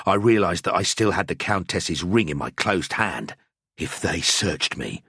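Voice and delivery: plain reading voice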